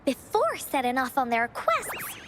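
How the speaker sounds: gentle storybook narration; story narration. A narrator's reading. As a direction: tell it like a bedtime tale to eager listeners. Encore narrates the Woolly Warrior tale.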